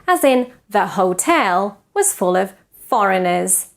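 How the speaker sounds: ordinary delivery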